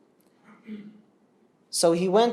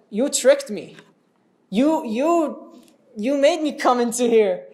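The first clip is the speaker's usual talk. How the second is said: accusing brother; 5 semitones higher, 3 dB louder, slower than usual. Acted. Brother accuses Musa of trickery.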